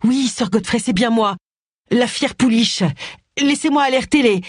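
worried, high voice at a bedside; urgent reassurance. A narrator's reading. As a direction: reassure a dying man while pressing to get help. Anxious answer to dying man.

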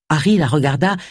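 ordinary delivery, nothing marked

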